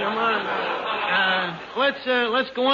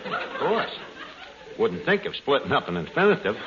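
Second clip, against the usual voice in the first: ordinary talk, nothing marked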